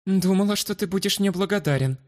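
meek. Acted. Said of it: "смиренно ответила женщина".

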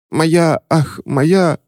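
high, broken fake weeping; hammed-up sobbing. A narrator's reading. Witch fake-sobbing before the clerk. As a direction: burst into theatrical tears. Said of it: "разрыдалась".

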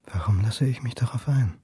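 breathy whisper to himself; whispered inner thought. Acted. Stachelmann doubting himself after the call, a private whisper.

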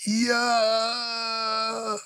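high, piercing scream of agony. A narrator's reading. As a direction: shriek in pain. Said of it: "Пронзительный вопль вырвался из горла".

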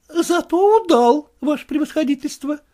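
высокий голос жалобного молодого просителя; жалуется генералу на тяжёлую жизнь; голос Куцина. Acted. Kutsin voiced. (молодой проситель Куцин жалуется генералу, голос выше)

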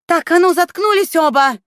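high, loud angry bark; barking shout. Acted. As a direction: shout them both down. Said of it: "рявкнула Светка".

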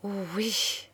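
very soft, hushed agreement; murmur. A narrator's reading. Claire agrees about a terrible death, murmuring. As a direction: murmur it under your breath. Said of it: "murmura Claire".

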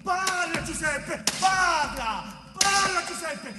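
angry interrogation. Acted. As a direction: hammer him with questions until he breaks. Interrogator pressing Giuseppe to confess. (interrogatorio furioso, gridando)

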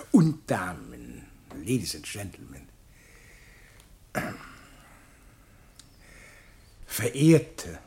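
ceremonious address with long pauses between the titles; pompous public speech; formal speech. Acted. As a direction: address the assembled society solemnly, like a festive orator.

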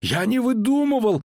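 high-pitched indignant protest; high, offended soldier voice. A narrator's reading. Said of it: "возмутился солдат".

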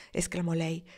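ordinary delivery

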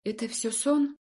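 hesitant, halting question; squeezed out. A narrator's reading. Stunned patient asks her rescuer, haltingly squeezed out. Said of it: "я смогла выдавить".

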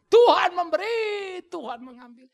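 high, loud, slow shout; full-voiced cry; shouted proclamation. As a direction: shout it out in faith. Job's words shouted again.

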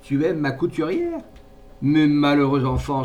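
mock him with outraged irony; fierce irony. A narrator's reading. Mother scorning his love for her seamstress, fierce irony. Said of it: "son accent vibrait d'une farouche ironie".

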